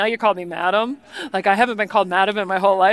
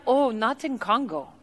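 softer, badly faked Congolese accent; mock Congolese accent; 5 dB quieter than usual. Tea lady corrects the excited guest, mock Congolese accent. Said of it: "her accent sounded nothing like this".

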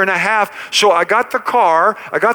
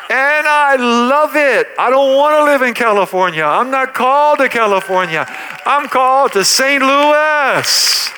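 preaching climax; 5 semitones higher than usual. Acted. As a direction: proclaim it to the congregation.

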